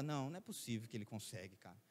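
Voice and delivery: low, quick, hushed aside; doubting inner thought. Retold or imitated. Preacher recalling his doubt about the pastor, hushed inner thought. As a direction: mutter it under your breath as a private thought.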